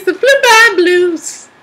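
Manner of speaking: high, slow cartoon voice; sing-song goofy high pitch; silly high voice. Silly voice on brand name.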